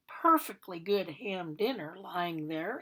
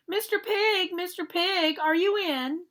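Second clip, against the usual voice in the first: high sing-song call; 9 semitones higher than usual. Acted. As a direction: call the pig out of his house. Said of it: "I called".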